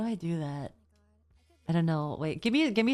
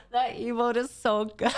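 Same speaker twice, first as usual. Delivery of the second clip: higher, amused voice breaking into laughter; laughing; 4 semitones higher than usual. Real, not acted. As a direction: say it while starting to crack up. Cracking up at an emote.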